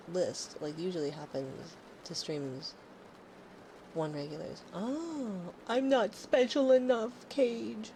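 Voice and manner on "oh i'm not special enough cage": higher, slow, whiny pout; mock hurt. Streamer teasing a viewer she's not special, mock hurt. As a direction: pout at him, playfully wounded.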